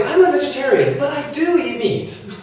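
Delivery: mock self-contradiction, pitched high; amused quoting of a silly claim; mocking quote. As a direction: poke fun at the claim with a laugh.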